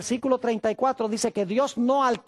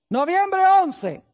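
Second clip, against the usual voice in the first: slow, higher and louder reply; sister's answer; 8 semitones higher, 4 dB louder, much slower than usual. Retold or imitated. Sister Hinojosa answering the pastor, slow and raised. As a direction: answer the pastor slowly and clearly. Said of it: "¿Noviembre qué?".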